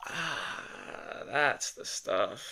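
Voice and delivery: slow, breathy sigh of relief into warm water; relaxed sigh. Acted. Man sinking into hot tub, sighing with slow relief. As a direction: let the heat melt you as you say it.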